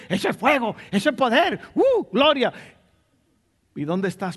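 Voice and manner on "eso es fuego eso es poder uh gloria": lightly mocking an excited churchgoer, higher; a hint of giddy churchgoer; mock enthusiast. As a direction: imitate a fan gushing over a loud preacher. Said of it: "mucha gente cree".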